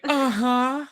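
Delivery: high, rising mock uh-huh; Padme. Fan replaying Padme's odd reply to friends, high-pitched. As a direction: mimic her in a tiny high voice.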